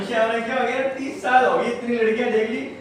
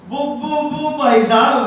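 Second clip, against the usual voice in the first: put-on stuttering boy; 4 semitones higher, 4 dB louder than usual. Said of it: "तुतला के बोलता है".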